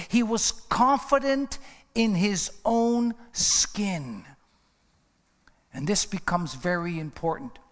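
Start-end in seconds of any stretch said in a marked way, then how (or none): 0.1-4.2 He was confident in his own skin, slowly, every word weighed; slow it right down and lean on "confident"; slow, deliberate restatement. Shown as a demonstration. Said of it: "Oh, I'm gonna focus".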